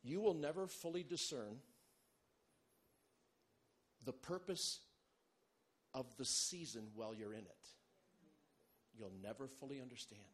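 usual speaking voice

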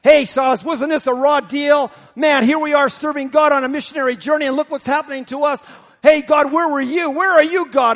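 loud, high, aggrieved whining at God; complaining shout. Acted. Paul complaining to Silas and God, loud whine. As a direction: play the wronged apostle yelling his complaint upward. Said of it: "Did they talk like that?".

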